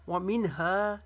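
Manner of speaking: व मिनहा with the last aa stretched; long हा. Shown as a demonstration. Teacher demonstrating madd, व मिनहा ending long. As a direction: hold हा at the end of व मिनहा. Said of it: "हा को एक अलिफ के जितना लंबा".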